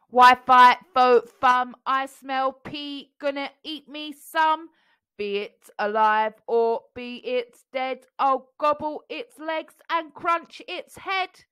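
loud, slow robot chant; robotic giant voice. A narrator's reading. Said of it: "a loud robotic voice".

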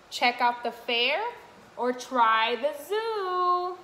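sing-song picture book read; reading to children. Acted. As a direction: read the rhyme brightly to little ones.

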